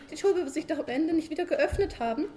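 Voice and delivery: high, loud wife pestering her sleepy husband; nagging wife. Acted. Frau Sumsum als Figur gesprochen. (hohe, laute nörgelnde Ehefrau)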